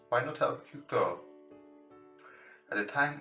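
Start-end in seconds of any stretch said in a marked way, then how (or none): none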